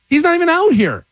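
high, insistent retold protest; indignant defense. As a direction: act out defending your son to a neighbor. Retold quarrel with the neighbor.

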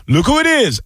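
high-pitched excited call to a buddy; imitated delighted recognition. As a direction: point out a celebrity to your friend. Retells man telling his buddy.

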